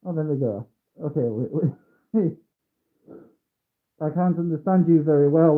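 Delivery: normal talking voice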